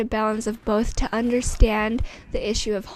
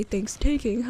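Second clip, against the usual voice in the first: joking. Real, not acted. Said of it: "or as I say".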